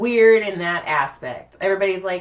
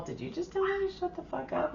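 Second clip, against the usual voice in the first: high, breathy, offended squeak; mock indignant; 12 semitones higher, 10 dB quieter than usual. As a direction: feign outrage at the doctor. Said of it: "And I'm all".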